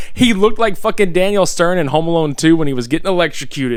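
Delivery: usual speaking voice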